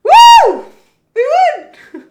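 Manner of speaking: shrieking high whoop of triumph; very loud, high celebration; cri de victoire. Real, not acted. Wins, celebrates loudly.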